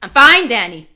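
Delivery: loud, clipped reassurance; firm brush-off. Pretend. Player character to Danny, forceful brush-off. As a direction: push him off hard.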